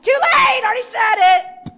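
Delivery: defiant, triumphant shout; mad at the devil. Retold or imitated. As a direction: slam the door on the devil. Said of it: "Until I'm mad".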